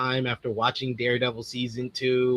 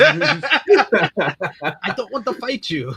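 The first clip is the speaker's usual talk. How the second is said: slow, higher, louder put-on plea; mock reluctant fighter; 7 semitones higher, 6 dB louder, much slower than usual. Co-host imitates Elena fighting Kate, slow mock plea. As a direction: draw it out, half laughing, pitched up.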